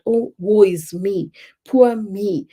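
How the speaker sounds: loud, drawn-out mock lament; mock self-pity. Looking back at her homesick self, mock self-pity. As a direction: play up the woe-is-me.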